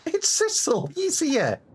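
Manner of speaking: a touch of tearful relief, voice up high; small girl glad help has come; high voiced relieved girl. Game character voiced in turns.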